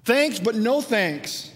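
dismissive rejection. Retold or imitated. People rejecting God's provision.

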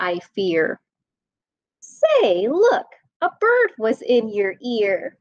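lively sing-song read-aloud voice; animated bedtime story reading; reading to children. A narrator's reading. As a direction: read it bouncy for little kids.

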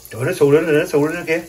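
loud amazed exclamation; excited. Real, not acted. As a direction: marvel out loud. Husband amazed at puffing dough.

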